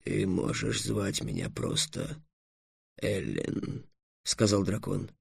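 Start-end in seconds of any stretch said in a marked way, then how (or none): 0.0-3.9 slow, soft dragon voice; hushed, reluctant concession; subdued dragon. A narrator's reading. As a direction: offer it quietly, sinking at the end. Dragon offers a short name.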